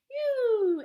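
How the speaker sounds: high, loud squeal; hog scream. Acted. The sprayed hog squeals, high and loud. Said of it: "who screamed".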